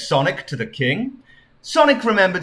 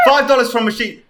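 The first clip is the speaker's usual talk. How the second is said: ordinary delivery, nothing marked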